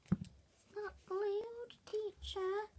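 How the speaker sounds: high, drawn-out mock pupil complaint; slow, high-pitched mocking tattle; tattling pupil. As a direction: mimic a child telling on someone to the teacher. Mimics pupil telling the teacher.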